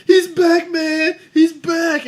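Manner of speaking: high, loud mock announcement; excited hype man. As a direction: imitate a thrilled buddy hyping his hero. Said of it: "that dude that's like".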